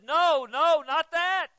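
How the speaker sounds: the text loudly refusing that reading; emphatic no. Retold or imitated. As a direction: shout the denial like scripture correcting you. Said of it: "it said".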